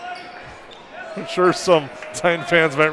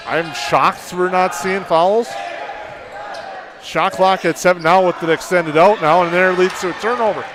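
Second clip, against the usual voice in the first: play-by-play. Real, not acted. Live play-by-play call.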